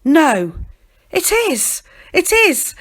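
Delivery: high, loud cry of recognition; excited insistence. A narrator's reading. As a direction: insist in a rising, thrilled cry. Annie recognises their lost boy.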